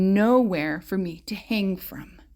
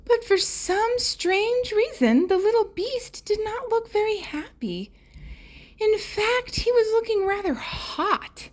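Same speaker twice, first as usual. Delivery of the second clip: high puzzled storytelling; 13 semitones higher than usual. A narrator's reading. Storyteller to child, wondering why the beast is sad. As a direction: lift it high, wondering what's wrong.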